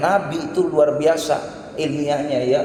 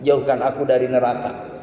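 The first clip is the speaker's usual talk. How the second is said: prayer; 4 semitones lower than usual. Acted. Opening prayer to God.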